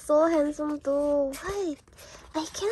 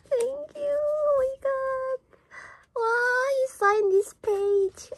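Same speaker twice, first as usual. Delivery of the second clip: high, loud thrilled gushing; thrilled fan; 10 semitones higher, 5 dB louder than usual. Real, not acted. Fan finding a signed page, thrilled squeal. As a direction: squeal your thanks, then marvel loudly.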